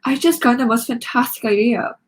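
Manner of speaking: inner scream. A narrator's reading. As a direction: burst with the idea. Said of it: "my brain was screaming".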